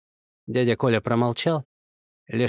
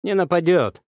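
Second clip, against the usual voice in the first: confident; 6 semitones higher, 4 dB louder than usual. A narrator's reading. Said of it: "уверенно сказал полицейский".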